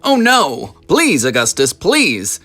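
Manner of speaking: high, loud pleading cry. Acted. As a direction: cry out in alarm, begging him to stop. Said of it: "he cried out".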